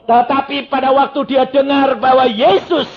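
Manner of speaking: loud, high, hammering sermon delivery; full-force preaching. Real, not acted. Preacher explains John's witness to the congregation, full-force preaching. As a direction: preach at the top of your force, pitched high.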